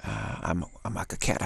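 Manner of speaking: ordinary talk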